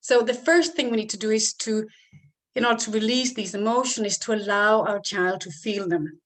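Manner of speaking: usual speaking voice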